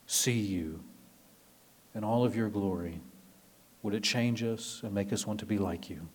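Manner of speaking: soft, low, unhurried prayer voice; closing a prayer softly before the sermon, hushed and reverent. Acted. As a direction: pray it gently to the congregation.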